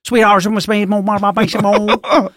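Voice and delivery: a fed-up Southern waitress losing patience; exasperated waitress. Retold or imitated. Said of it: "the lady's getting exasperated".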